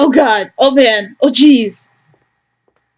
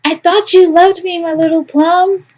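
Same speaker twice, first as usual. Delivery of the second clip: higher, syrupy hurt mom; higher doting mom, mock hurt; wounded mother; 9 semitones higher than usual. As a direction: pour on the guilt sweetly. Mother guilt-trips son.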